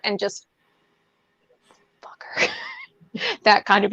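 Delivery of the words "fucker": whisper. Pretend. Whispered curse after quote.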